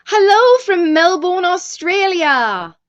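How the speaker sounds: loud, high, hammed-up Australian greeting; bad Australian accent. Streamer reads a viewer's hello from Melbourne, bad Australian accent. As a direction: call out in a badly faked Australian accent, stretching the place name. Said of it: "I'm really bad at accents".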